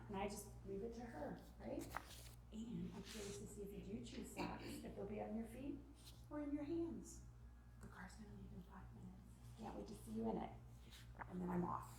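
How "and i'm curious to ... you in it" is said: hushed, low-key parent voice; calm, hands-off. Shown as a demonstration. Mom leaving sock choice to daughter, quietly calm. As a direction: state it softly and leave it there.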